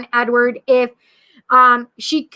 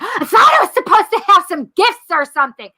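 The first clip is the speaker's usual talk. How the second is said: high, mock-indignant yell; re-enacted bratty shouted complaint, pitch raised; retold yelling; 7 semitones higher, 4 dB louder than usual. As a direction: yell it upward like a demanding brat. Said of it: "in my car yelling that to Spirit".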